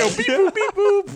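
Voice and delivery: high, robotic beep boop. Retold or imitated. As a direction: imitate electronic glitch sounds. Mocking hyperpop random sounds.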